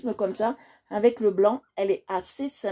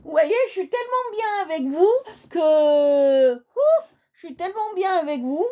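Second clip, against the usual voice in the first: high, loud surprised cry; startled at the hour, high and loud; 9 semitones higher, 3 dB louder than usual. Real, not acted. Realising the time, excited cry.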